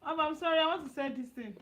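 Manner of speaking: ordinary talk, nothing marked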